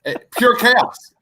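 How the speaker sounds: high, drawn-out burst of delight; gleeful, slow emphasis; amused exclamation. Real, not acted. Laughing exclamation, then said plainly.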